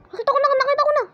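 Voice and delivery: very high, loud repeated cry; frantic high-pitched excitement; excited squeal. Real, not acted. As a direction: squeal that you spotted it. Spots what was searched for.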